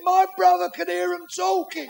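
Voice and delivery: high, slow holler; lookout's call. Acted. Lookout explaining himself to the captain, calling down. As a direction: call it out across a distance.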